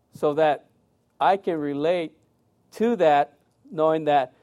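normal talking voice

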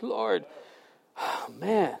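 low, soft "Lord", then a heavy sigh; grieved sighing prayer. Retold or imitated. Preacher recalling his cry to the Lord, grieved sigh. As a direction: sigh it quietly, weighed down.

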